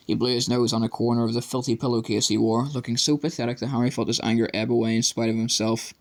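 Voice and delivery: plain reading voice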